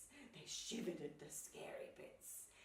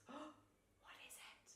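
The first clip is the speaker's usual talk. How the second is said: slow, hushed whisper; barely-there storybook whisper; 8 semitones higher, 11 dB quieter, much slower than usual. A narrator's reading. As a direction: whisper it, wary and curious. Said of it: "she whispered".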